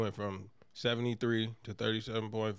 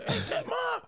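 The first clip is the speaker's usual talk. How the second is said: much higher, frantic shouting; panicked yell. Retold or imitated. Host as Franklin shouting at Teddy to give the password, frantic.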